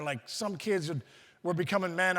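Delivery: ordinary talk, nothing marked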